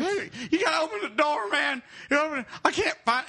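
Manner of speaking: imitated panic, borderline crying; very high, frantic mock wail; Joey. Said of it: "like, borderline crying and shit".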